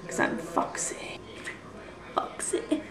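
teasing whispered "foxy"; playful whisper. Acted. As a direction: whisper it like a flirty secret. Joking about fox hat.

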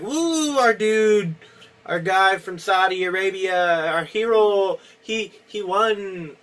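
lightly put-on Saudi Arabian accent, high cheer; mock a Saudi fan cheering in a bad accent; terrible Saudi accent. Mock Saudi fan cheering his hero, bad Saudi accent. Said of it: "that was a terrible Saudi Arabia accent".

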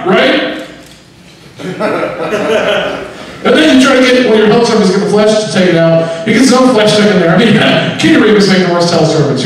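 high-pitched devil working the crowd; devil doing stand-up. Acted. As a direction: play a hammy devil comic in a high voice. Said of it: "the devil was doing stand-up".

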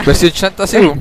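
shouted teasing; loud mocking jab. Real, not acted. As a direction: bark the joke over the others. Amigos se burlan a gritos. (burla gritada)

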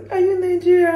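high, loud mimicked question; put-on high-pitched asking voice; mock questioner. Acting out someone asking about accent.

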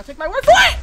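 high, very loud boastful cry; triumphant shout. Real, not acted. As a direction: shout it proudly to viewers. Boasting while fighting creeper.

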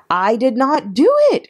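high, loud acted protest; screamed denial. A narrator's reading. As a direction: cry it out high, indignant. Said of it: "she screamed".

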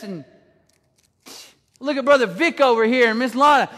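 ordinary delivery

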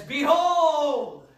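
a preacher cutting off his sentence to shout behold. Retold or imitated. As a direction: burst out with behold to stop the crowd. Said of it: "that kept your attention".

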